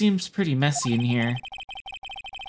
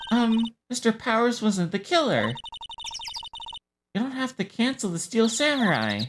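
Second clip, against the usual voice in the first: put-on high-pitched girlish game-character voice; eager girl sidekick; 11 semitones higher than usual. Sidekick pleading about the Steel Samurai, high girlish voice.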